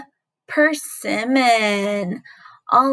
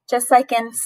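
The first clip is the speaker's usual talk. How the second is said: usual speaking voice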